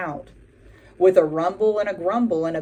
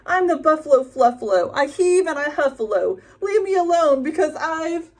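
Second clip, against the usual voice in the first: higher, loud snarling chant; snarling boast; 9 semitones higher, 5 dB louder than usual. A narrator's reading. Buffalo snarling his boast again, higher and louder. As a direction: stomp and snarl the rhyme. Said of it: "snarled and gnarled and stomped with a huff".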